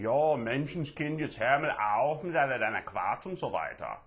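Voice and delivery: hammed-up German scolding accent. German in Germany scolds someone, mock German accent. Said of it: "The guys in Germany going".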